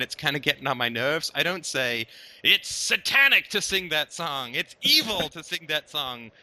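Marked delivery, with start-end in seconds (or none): 2.4-6.3 slow, drawn-out mock outrage; over-the-top accusing proclamation; exaggerated condemnation. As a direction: denounce it like a hammed-up zealot. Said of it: "that's a bit of an exaggeration".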